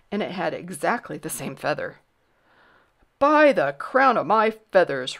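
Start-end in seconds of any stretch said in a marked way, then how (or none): 3.2-5.2 loud, outraged royal oath; big kingly exclamation; roaring. A narrator's reading. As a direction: roar it as an exasperated king. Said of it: "roared the king again".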